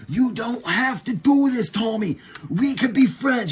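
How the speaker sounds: hammed-up heroic persuasion; mock pleading. Rangers urging Tommy to be friends, mock pleading.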